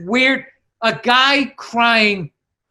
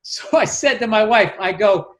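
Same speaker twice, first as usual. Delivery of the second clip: ordinary delivery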